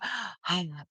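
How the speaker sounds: a quiet, tired exhale; soft weary sigh. Retold or imitated. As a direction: breathe out the stress.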